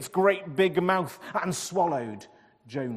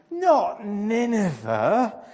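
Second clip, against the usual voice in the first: high, slow, drawn-out groan; whiny reluctant groan; 7 semitones higher, 9 dB louder, slower than usual. A narrator's reading. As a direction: moan the words in misery. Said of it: "he groaned".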